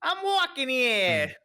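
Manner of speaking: slow, high-pitched New York yell; exaggerated New York holler; New York accent. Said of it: "New York, New York is saying".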